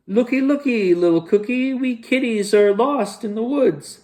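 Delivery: high, cutesy pleading voice; disguised kitty. Acted. Disguised Wally pleads with cookie in a high cutesy voice. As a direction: plead sweetly as a lost kitty. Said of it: "said Wally".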